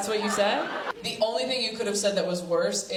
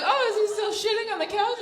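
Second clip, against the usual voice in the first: much higher, sing-song pet-owner pitch; mock dog-owner voice; 12 semitones higher than usual. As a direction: chirp it like asking about a puppy. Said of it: "I'm like".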